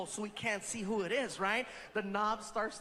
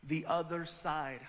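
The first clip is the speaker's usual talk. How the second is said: lower, softer emcee build-up; host intro; 7 semitones lower, 4 dB quieter than usual. Acted. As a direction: set up the big reveal.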